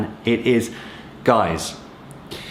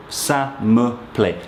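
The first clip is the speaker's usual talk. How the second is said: slow repeat; slower than usual. Shown as a demonstration.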